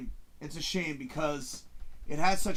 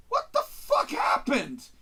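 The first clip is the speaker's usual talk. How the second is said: much higher, slow incredulous question; outraged disbelief; slower than usual. Retold or imitated. Viewer aghast at the ruined ending, high slow disbelief. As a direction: squeal it out, aghast. Movie ruined in third act.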